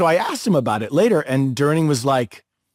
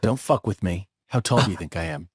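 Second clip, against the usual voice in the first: low, quiet intimidation; Charles Durning; 7 semitones lower, 5 dB quieter than usual. Retold or imitated. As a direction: drop the voice to challenge someone. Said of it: "He goes".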